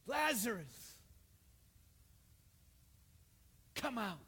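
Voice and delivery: Lazarus laut und gedehnt gerufen, come out kräftig fallend; loud call. Retold or imitated. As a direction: call him out of the tomb. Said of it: "Jesus called in a loud voice".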